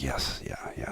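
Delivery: whispered yes. Retold or imitated. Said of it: "And it's like".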